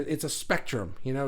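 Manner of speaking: ordinary delivery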